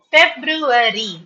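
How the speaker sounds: "February" drawn out as feb-ru-a-ry; slow, four-beat "February" with every syllable sounded; careful pronunciation. Shown as a demonstration. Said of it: "That is how you have to pronounce".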